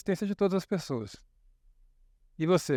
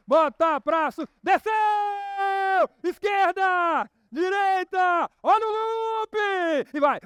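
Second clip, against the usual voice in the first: high shouted ride calls; roller coaster drop; 12 semitones higher, 3 dB louder, slower than usual. Pretend. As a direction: scream through the drop and the loop. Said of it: "Vamos na montanha-russa".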